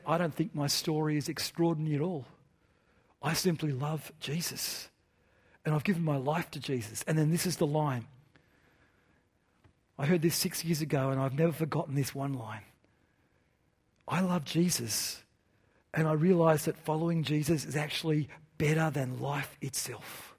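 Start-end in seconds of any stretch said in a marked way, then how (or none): 0.1-7.0 soft, humble retold testimony; nervous, quiet. Young man answering host before huge auditorium, quietly. 14.1-20.3 soft, measured retold confession; quiet testimony. Young man confessing his faith to the auditorium, quietly.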